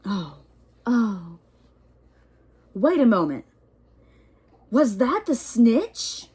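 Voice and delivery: the commentator can't believe his eyes; excited surprise. Acted. Commentator spots snitch.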